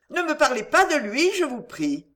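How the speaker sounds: understated indignant request, starting high; upset refusal. Acted. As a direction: forbid the subject with mild agitation. Heroine refuses to discuss her friend.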